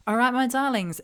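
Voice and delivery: bright, loud, high hello to viewers; excited greeting. Real, not acted. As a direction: call out warmly to the audience. Loud greeting opening sign-off.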